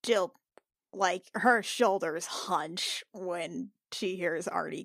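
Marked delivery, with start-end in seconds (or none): none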